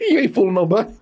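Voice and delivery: teasing through laughter; laughing. Real, not acted. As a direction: laugh while mocking him.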